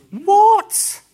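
loud, high outburst of disbelief; shocked what. Real, not acted. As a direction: blurt it out in disbelief. Preacher's own disbelief.

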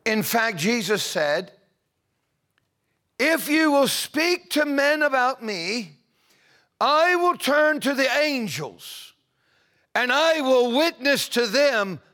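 loud, high, soaring preacher's cry; preaching at full force. Acted. Preacher lifting the congregation into prayer, full force. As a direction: lift the room, stretching the key words slow.

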